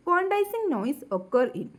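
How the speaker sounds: reads the question out very slowly, word by word; slow question reading. Acted. Teacher reading exam question aloud to students, very slow dictation.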